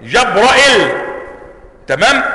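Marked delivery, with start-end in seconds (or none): 0.0-0.9 جبرائيل said crisply with the ya dropped after the hamza; a brief, clear جبرائيل with hamza and no ya; [name]'s reading. Shown as a demonstration. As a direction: pronounce جبرائيل without the ya, ending straight on the lam. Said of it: "مثلما سبق مع حذف الياء".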